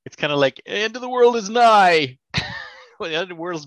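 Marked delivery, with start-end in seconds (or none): 0.7-2.2 high, slow doom-crier voice; doomsday prophet. Retold or imitated. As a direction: wail it like a street prophet. Doomsayer quote.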